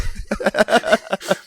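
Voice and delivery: bright burst of high laughter. Real, not acted. Laughing at the delivery driver joke.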